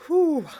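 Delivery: high, loud falling whoop of admiration; loud swoony exhale, sliding down from high; swooning whew. Pretend. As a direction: let out a smitten whew. Swooning over Flynn Rider.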